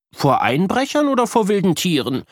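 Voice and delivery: put-on high child voice asking; high curious boy voice; little brother. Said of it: "fragt Luca weiter".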